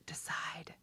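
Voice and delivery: soft whisper into cupped hands. Shown as a demonstration. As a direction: breathe the word out, no voice. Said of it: "you're just gonna whisper into your hands".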